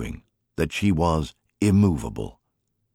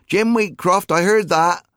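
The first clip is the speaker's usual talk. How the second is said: high, louder call after him; shouted call; 13 semitones higher, 5 dB louder than usual. Acted. Mary shouting after her teasing husband, high loud call. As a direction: yell it across the field.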